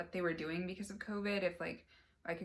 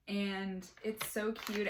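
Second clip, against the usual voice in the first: normal talking voice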